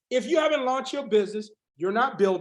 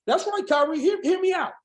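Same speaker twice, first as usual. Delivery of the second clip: ordinary talk, nothing marked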